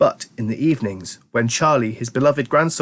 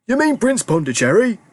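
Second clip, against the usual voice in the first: put-on fond old grandpa; 10 semitones higher than usual. A narrator's reading. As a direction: savour the words like an old grandpa. Grandpa Joe voiced.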